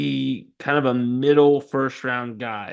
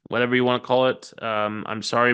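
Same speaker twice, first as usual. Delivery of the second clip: ordinary talk, nothing marked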